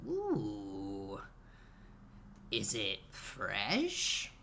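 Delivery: put-on low, very slow, intrigued old man; old shopkeeper. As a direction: draw it out as a curious old alchemist, rising sharply at the end. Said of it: "you're met by an old man".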